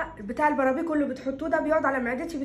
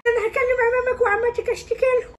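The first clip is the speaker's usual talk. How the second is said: higher-voiced indignant old grandmother; a wounded granny protesting; offended grandma; 9 semitones higher, 6 dB louder than usual. Grandmother threatens to complain. (جدة زعلانة بصوت أعلى)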